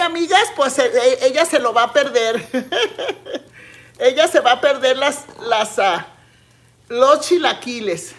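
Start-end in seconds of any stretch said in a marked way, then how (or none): 2.5-3.4 short high giggle; a quick teasing laugh. Real, not acted. Laughing to her friends that Liz will miss the food, a high giggle.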